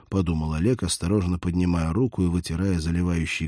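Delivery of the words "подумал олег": ordinary delivery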